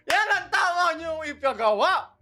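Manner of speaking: hyping up the viewers; excited cheer. Real, not acted. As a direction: burst out in delight. Cheering the cat meme.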